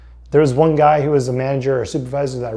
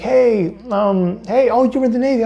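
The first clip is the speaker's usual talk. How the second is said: high, eager friendly voice; friendly stranger; 9 semitones higher than usual. Retold or imitated. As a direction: play the chatty vet stopping you. Veteran stranger voiced, high pitch.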